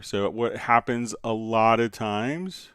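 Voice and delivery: usual speaking voice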